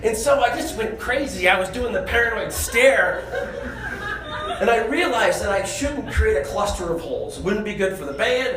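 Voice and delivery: ordinary talk, nothing marked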